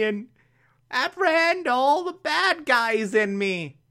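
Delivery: put-on high, pleading, tearful girlfriend voice; clingy girlfriend. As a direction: beg him to stay like a desperate girlfriend. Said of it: "the clingiest girlfriend ever".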